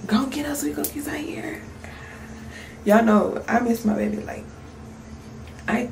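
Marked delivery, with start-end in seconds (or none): 0.1-1.6 soft whisper, higher pitched; whispered quote. Retold or imitated. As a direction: whisper it like a sleepy kid. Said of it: "Teacher like".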